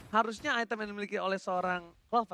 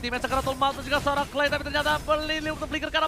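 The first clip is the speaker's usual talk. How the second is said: high, loud hype call; fighting game announcer; 10 semitones higher, 10 dB louder than usual. Acted. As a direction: push it up for the comeback. Hype esports play-by-play.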